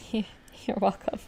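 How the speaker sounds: slow, very soft whisper after a laugh; whispered reply. Real, not acted. Friend answering thanks for making up for it, whispered reply. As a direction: whisper it through a little laugh.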